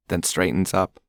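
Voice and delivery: ordinary delivery, nothing marked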